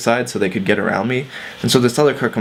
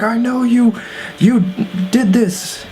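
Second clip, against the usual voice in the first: mock yell; 13 semitones higher, slower than usual. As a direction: imitate a drunk man screaming accusations. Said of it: "he's just screaming at them".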